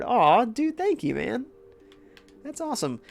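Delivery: high, delighted thank-you; excited thanks. Real, not acted. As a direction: gush with happy gratitude. Thanking for a gift.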